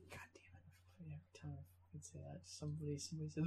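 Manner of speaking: hushed, breathy cursing under the breath; a cohost groaning at the band-camp joke; whispered frustration. Real, not acted. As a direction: hiss it to yourself, exasperated. Annoyed at an old joke.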